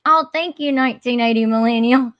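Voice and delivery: ordinary talk, nothing marked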